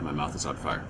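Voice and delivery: usual speaking voice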